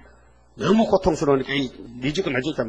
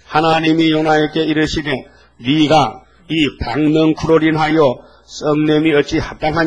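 scripture reading; 4 dB louder than usual. Acted. Reads God's words to Jonah.

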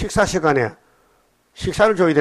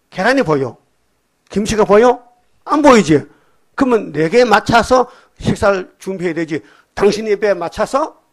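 imitating an indignant telling-off; exasperated questions building higher and louder; scolding; 4 semitones higher, 4 dB louder than usual. Blind preacher chiding the server over the meal, scolding. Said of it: "막 야단치는 거예요".

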